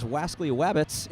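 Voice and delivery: "wascally wabbits" with W in place of each R; joking cartoon-style "wascally wabbits"; cartoon lisped R's. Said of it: "I see what you did there".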